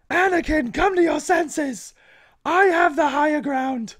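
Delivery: high, loud mock yell, slowed; hammed-up movie yell down at Anakin; Obi-Wan yelling. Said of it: "Obi-Wan's yelling down at Anakin".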